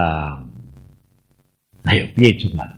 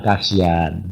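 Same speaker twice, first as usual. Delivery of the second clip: 1 God's pity; 8 semitones lower, 4 dB quieter, slower than usual. Retold or imitated. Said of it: "Tuhan bilang".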